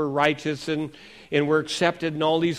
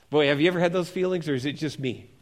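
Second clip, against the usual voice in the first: normal talking voice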